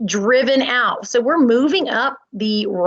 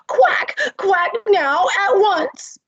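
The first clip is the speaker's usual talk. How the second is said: mother duck demanding; 9 semitones higher, slower than usual. Acted. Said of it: "Madam Duck to the ugly duckling".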